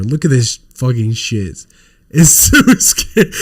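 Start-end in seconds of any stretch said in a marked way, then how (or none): 2.1-3.3 giggling disbelief; laughing. Real, not acted. Streamer showing an ugly toy, laughing. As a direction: laugh bursts mid-line.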